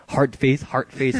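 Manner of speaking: ordinary talk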